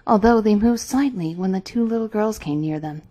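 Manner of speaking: usual speaking voice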